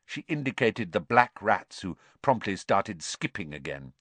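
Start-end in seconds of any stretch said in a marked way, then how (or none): none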